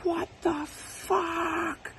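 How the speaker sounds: high shouted cry, slowly dragged; yelled cry of shock; screaming back. Retold or imitated. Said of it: "I screamed back at her".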